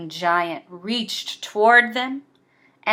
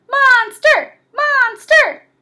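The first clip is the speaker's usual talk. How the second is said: very high, loud cheer; 8 dB louder, slower than usual. Acted. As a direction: cheer after him as he runs. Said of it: "they cheered after him".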